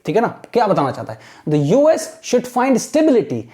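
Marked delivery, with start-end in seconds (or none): 1.5-3.4 slow, careful reading aloud; teacher's model reading. Shown as a demonstration. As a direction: read it out slowly for students to copy. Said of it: "अब इसको कैसे पढ़ना है आप".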